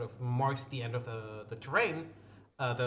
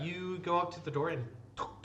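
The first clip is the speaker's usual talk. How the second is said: usual speaking voice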